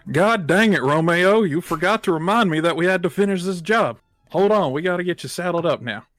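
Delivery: loud high-pitched Southern knight; twangy country knight hollering at his horse. Acted. Knight scolding his Ponyta Romeo, Southern twang.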